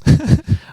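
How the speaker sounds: breathy high-pitched giggle; high nervous giggle. Real, not acted. Nervous laugh before reveal.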